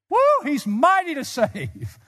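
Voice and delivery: high, loud, drawn-out praise; exultant whoop. Real, not acted. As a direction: whoop it out slowly in jubilant preaching. Whooped repeat of the same words.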